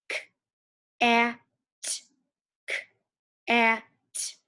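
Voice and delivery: K, a, t said as separate sounds; sounding out letters. Shown as a demonstration. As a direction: split cat into K, a, t. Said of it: "Let's sound it out".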